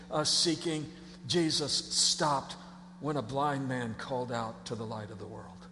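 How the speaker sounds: solemn quiet storytelling; hushed awe. Real, not acted. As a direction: drop to a reverent hush. Awed hush at Jesus stopping.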